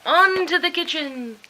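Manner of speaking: high, loud triumphant call; gleeful cry. Acted. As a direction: crow out the victory. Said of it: "and cried".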